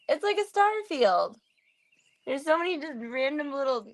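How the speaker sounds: high delighted exclaim; excited. Real, not acted. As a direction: gush over the star field. Excited repeat.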